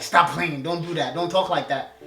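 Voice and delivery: ordinary talk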